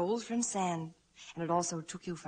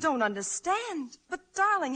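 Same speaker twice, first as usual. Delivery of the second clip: hurt disbelief; 8 semitones higher, 5 dB louder than usual. Acted. Wife hurt her husband doubts her.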